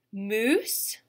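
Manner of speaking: usual speaking voice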